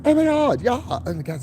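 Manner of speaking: high, gushing put-on voice; code-switching voice. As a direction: gush like a fake-sweet coworker. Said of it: "It's like".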